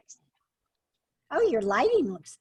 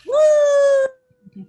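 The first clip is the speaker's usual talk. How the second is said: loud high whoop; cheering; 14 dB louder than usual. Real, not acted. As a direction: whoop it up for the speaker. Cheering the introduction.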